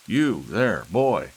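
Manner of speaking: put-on loud, high imperious old grandfather; gruff patriarch calling out; commanding old man. A narrator's reading. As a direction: summon him like a servant.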